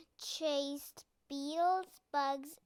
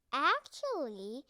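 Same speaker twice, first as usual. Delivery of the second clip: ordinary delivery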